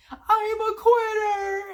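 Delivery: high, slow, crying yell; anguished shout. Retold or imitated. SpongeBob giving up and running for the door, crying out. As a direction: wail it in despair. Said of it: "so he starts, like".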